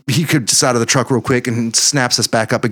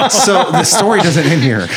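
laughing; 9 semitones higher, 3 dB louder than usual. Real, not acted. Laughing through the lines.

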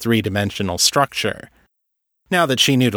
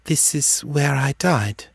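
put-on higher, distant ghostly girl; eerie hollow voice; 5 semitones higher than usual. A narrator's reading. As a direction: speak as if from far away. Possessed girl speaking.

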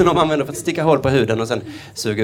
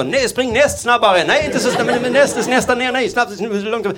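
much higher, slow and laughing, confused orders; frantic driver; 8 semitones higher, slower than usual. Acted. Harness driver to horse, frantic and high. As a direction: shout muddled orders at the horse.

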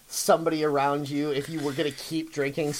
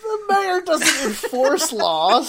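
laughing protest; 12 semitones higher, 7 dB louder, slower than usual. Real, not acted.

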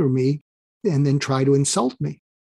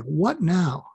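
animosity. Retold or imitated. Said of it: "looks at me with this animosity".

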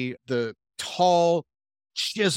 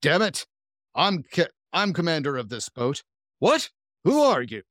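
raised, higher-pitched commanding voice; brusque captain; 5 semitones higher than usual. A narrator's reading. Boat commander confronting someone in his chair, brusque and indignant. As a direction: order them out like an irate commander. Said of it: "you hear the same voice say".

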